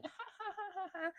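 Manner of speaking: high mock giggle of Raquel; breathy imitation of her laugh; imitated giggle. Vlogger mimicking Raquel's smug laugh on the couch, mock giggle. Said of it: "And she's laughing".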